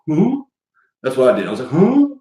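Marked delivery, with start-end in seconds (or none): 0.1-0.4 confused dog huh. Retold or imitated. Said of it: "Like this". 1.7-2.1 confused dog huh. Retold or imitated. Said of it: "Like this".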